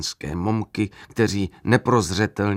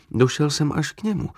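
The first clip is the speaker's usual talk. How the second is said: ordinary delivery, nothing marked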